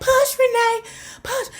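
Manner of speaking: high, urging midwife voice; midwife coaching. Retold or imitated. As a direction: coax the mother through labor. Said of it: "She was like".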